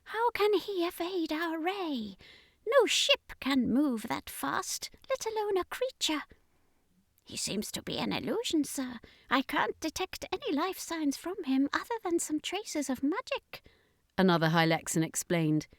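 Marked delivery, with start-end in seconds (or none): 0.0-6.2 high, soft, alien murmur, irritated; a sneering alien muttering contempt; high hushed alien voice, annoyed; angry alien commander. Acted. 7.2-13.6 high, soft, alien voice, deferential report; an alien officer reporting to his commander; high hushed alien reporting; alien subordinate. Acted.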